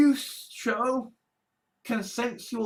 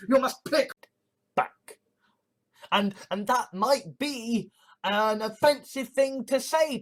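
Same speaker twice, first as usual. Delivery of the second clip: ordinary talk, nothing marked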